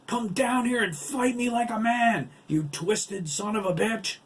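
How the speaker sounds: high, loud angry challenge; furious bellow. A narrator's reading. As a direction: bellow a dare at your enemy. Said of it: "Lyle bellowed".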